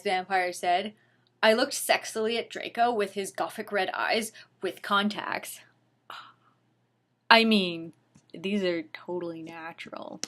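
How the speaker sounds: ordinary delivery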